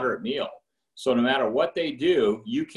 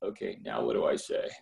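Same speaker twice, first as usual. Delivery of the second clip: awkward thought; 10 semitones lower, 6 dB quieter than usual. Retold or imitated. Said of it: "And then you're like".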